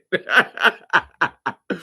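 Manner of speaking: loud, rhythmic run of laughs; laughter. Real, not acted. Host tickled by the caller's words, laughing hard. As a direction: keep laughing after the point lands.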